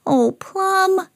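very high disappointed reproach; high-pitched, drawn-out dismay; Sam. A narrator's reading. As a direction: lament a naughty dog in a high voice. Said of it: "said Sam".

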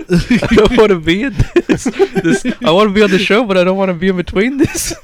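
high-pitched laughing protest; giggling through the words. Real, not acted. Laughing refusal to join.

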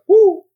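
high loud woo call; imitated hunting call. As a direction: call out woo to lure coyotes. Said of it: "making a noise".

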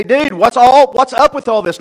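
high, loud gripe at the preacher; loud mock complaint. Thirsty people confronting their leader, loud mock complaint. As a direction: complain loudly as the fed-up crowd.

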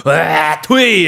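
hocked-up spit sound, loud and high; spitting noise. Retold or imitated. Imitating a man spitting on the street. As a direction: mimic a guy hawking and spitting. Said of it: "you got the guys who go".

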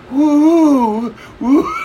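high, loud drawn-out "woo"; mocking whoop. Retelling [name] jeering at the Eagles, mocking whoops.

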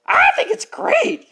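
high, slow, excited little pig voice; squeaky little girl. Acted. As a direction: beam it out like a tiny girl. [name] answers in a squeaky voice.

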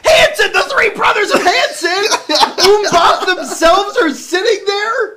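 very high, loud squeal through laughter; thrilled shrieking. Real, not acted. Cohost learns [name] is the celebrity guest, thrilled shrieking. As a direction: shriek in giddy disbelief.

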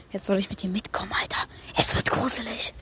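hushed, voiceless whisper; secretive whispering; spooky whisper. Pretend. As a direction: whisper it as a creepy aside. Whispers aside that it gets creepy.

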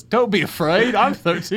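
high mock British little-boy voice; British cat boy. Joking as the movie cat claiming thirteen, high British voice. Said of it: "little boy in a cat body".

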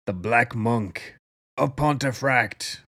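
slow, portentous announcement; dramatic title reveal. Pretend. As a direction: draw the title out slowly for mock suspense. Dramatic announcement of the episode's topic.

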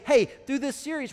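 ordinary talk

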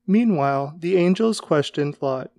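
plain reading voice

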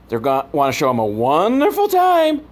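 usual speaking voice

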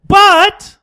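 high loud drawn-out but; loud objection. Real, not acted.

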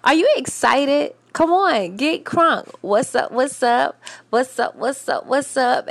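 high, loud, hyped-up greeting; hype radio host. Acted. Host greets listeners to get them excited, hyped up. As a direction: fire up the crowd as show host. Hypes up listeners to open show.

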